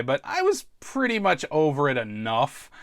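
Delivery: ordinary delivery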